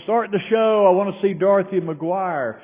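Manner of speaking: high, loud demanding shout; loud yell. Retold or imitated. Heckler in the theatre demands the show start, loud yell. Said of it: "she yells again".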